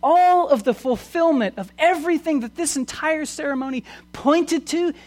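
high, emphatic pulpit delivery; impassioned preaching. Real, not acted. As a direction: preach it out with rising fervour. Preaches at full force.

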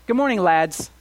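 loud cheery call across the water; hearty British greeting. Retold or imitated. Preacher voicing a hearty British greeting, loud and cheery. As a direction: call out to the lads like a British mate. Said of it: "what the British would say".

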